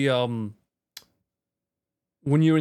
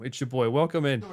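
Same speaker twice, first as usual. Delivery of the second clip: normal talking voice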